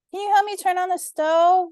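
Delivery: put-on high, childlike voice calling loudly; little girl shouting. A narrator's reading. Lovey shouting up to big sister, high childlike plea. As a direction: shout the favour up to her room. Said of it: "Lovey shouted back".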